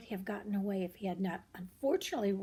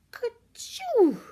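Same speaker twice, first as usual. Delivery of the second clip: high loud mock sneeze; a big hammed-up sneeze; 12 dB louder than usual. Peter sneezing in the tool shed, loud and high.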